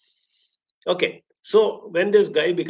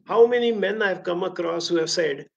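ordinary talk

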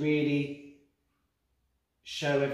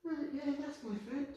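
much higher and softer, distant; spirit's voice; 6 dB quieter than usual. Retold or imitated. Medium channelling the spirit's words, high and soft. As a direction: let the spirit speak through you, soft.

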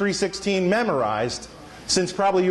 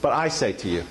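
much lower, measured authority; Jesus; 9 semitones lower than usual. Retold or imitated. Preacher quotes Jesus claiming his own authority, low and authoritative. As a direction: drop the pitch and state it with finality. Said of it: "Jesus doesn't talk like that".

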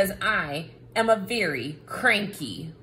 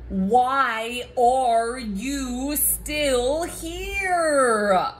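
slow, drawn-out, louder exasperation; exasperated; 3 semitones higher, 4 dB louder, much slower than usual. Acted. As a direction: drag it out, fed up the reader is still here. Cranky book annoyed reader stayed.

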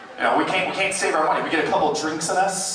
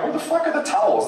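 very high, indignant squeal; mock baffled outrage at no towels; hammed-up disbelief; bathroom outrage; 14 semitones higher than usual. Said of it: "I'm like".

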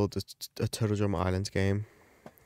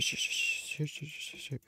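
breathy fading repeats; whispered trailing off; 5 semitones higher, 10 dB quieter than usual. Real, not acted. Trailing off muttering shoot as the round ends. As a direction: let it fade to a whisper.

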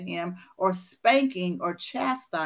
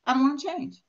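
lightly voiced stubborn whine, a touch higher; stubborn refusal; 5 semitones higher than usual. Coach imitating a resistant client, stubborn whine. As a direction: mimic someone digging in their heels.

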